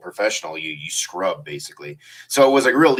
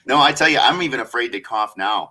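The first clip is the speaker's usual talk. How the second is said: usual speaking voice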